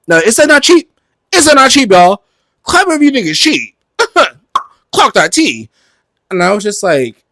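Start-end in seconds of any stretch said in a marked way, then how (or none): 0.1-5.7 high, loud campy hype man; hammed-up high sales cheer; mock sales hype. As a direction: work the crowd in a high, loud voice. Mock sales hype for cheap Teslas.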